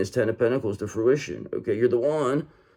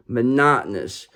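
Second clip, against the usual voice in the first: usual speaking voice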